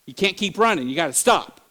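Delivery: ordinary talk, nothing marked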